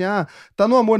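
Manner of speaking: imitating people who think it's all love; mocking quote. Host mocking what people assume about rivals, high and mocking. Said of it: "pensam assim".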